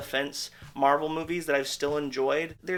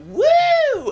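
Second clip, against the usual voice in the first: loud, high whoop of delight; a sudden excited whoop; mock cheer; 13 dB louder than usual. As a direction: let out a big sarcastic cheer. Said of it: "And you're just like".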